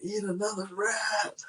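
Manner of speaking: high and slow, relishing each word; mock gleeful demand. [name] urging the man to eat another rat, high gleeful drawl. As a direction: savor the order like a giddy sadist.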